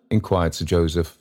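usual speaking voice

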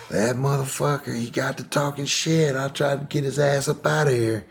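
high, lightly angry aunt voice; a hint of a scornful aunt; imitating angry aunt. Aunt telling nephew about her husband, high scornful voice. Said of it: "She was like".

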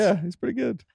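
a teasing impression of a fan; mock clueless fan. Mocking a fan's vague reply.

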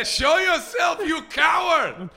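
high, slow shout in a bad French accent; pissed-off French chef calling someone out, badly faked; Ludo Lefebvre. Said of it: "can't do a good French".

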